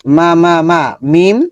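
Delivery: clear, repeated Arabic syllables, each one said crisply; syllable drill. Shown as a demonstration. Teacher drills students on letter sounds, crisp repetition. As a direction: sound out তি again and again for the class. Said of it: "আমরা এইভাবে practice করবো".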